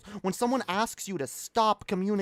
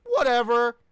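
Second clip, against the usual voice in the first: dismissive whatever; 5 semitones higher, 4 dB louder than usual. Retold or imitated. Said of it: "YouTube's just like".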